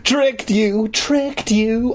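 high sing-song taunt; cheering. A narrator's reading. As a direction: cheer gleefully at the victim. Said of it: "I cheered".

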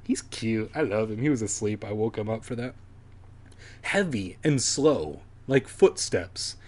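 usual speaking voice

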